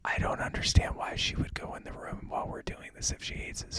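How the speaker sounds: hushed whisper. Pretend. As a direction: keep it a whisper. Whisper segment.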